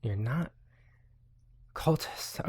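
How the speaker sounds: soft, whispered doubt; quiet. Acted. Journalist murmuring doubtfully at the party's denial. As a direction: drop to a whisper on cultists.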